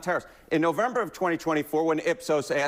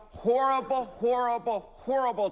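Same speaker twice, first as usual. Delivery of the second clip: lightly voiced Charles Barkley, higher; a hint of Charles Barkley drawing out horrible, horrible, horrible; light Charles Barkley impression, horrible said three times; 5 semitones higher than usual. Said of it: "to quote Charles Barkley".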